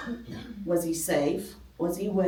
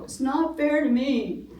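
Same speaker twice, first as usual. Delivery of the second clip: higher-pitched mock whine of a little girl; whiny little daughter; 8 semitones higher than usual. Mother imitating her daughter complaining, whiny. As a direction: imitate the youngest child complaining. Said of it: "she would go".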